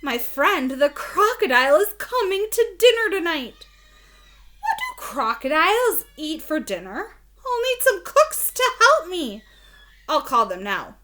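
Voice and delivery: teacher reading Cass with excitement; excited Cass. Acted. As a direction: announce it like big news to the reader. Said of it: "said Cass".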